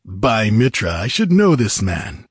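mildly amazed character voice; surprised recognition. A narrator's reading. Rider peering at the man on the cross, mildly surprised. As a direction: exclaim lightly as recognition dawns.

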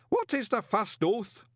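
high, slow ritual question; booming demand. A narrator's reading. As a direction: demand the oath with drawn-out ceremony. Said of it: "the booming voice demanded again".

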